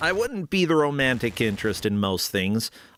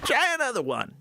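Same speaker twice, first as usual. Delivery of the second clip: higher old prospector impression; 8 semitones higher than usual. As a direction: croak it like the game's miner. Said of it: "I could be a prospector".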